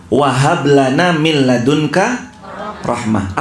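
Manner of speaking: slow chanted Arabic recitation; Quranic recitation. Acted. Preacher recites the verse to the congregation, chanted slowly. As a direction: intone the verse slowly and melodically.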